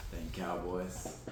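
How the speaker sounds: low, soft, slow grumble; slow, quiet mock lament; muttered lament. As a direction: sigh it out under your breath. Mock lament over Cowboys.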